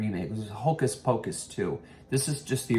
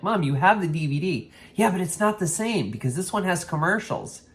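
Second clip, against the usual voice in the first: mock exasperation, then a higher mom voice; exasperated son then mom; 9 semitones higher, 4 dB louder than usual. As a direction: argue it like a mother-son quarrel. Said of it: "I'm like".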